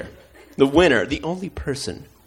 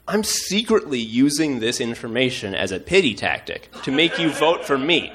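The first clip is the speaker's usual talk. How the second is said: normal talking voice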